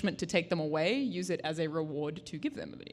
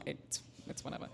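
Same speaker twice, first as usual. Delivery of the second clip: whispered aside; 5 semitones lower, 14 dB quieter than usual. Real, not acted. Dismissive aside after a joke.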